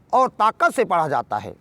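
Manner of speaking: normal talking voice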